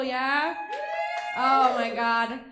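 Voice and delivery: ordinary talk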